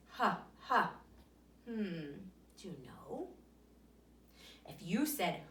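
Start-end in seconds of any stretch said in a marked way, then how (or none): 0.1-0.9 Huh, huh as a breathy h sound. Shown as a demonstration. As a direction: push out a breathy h. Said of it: "the beginning sound of his name sounds like this".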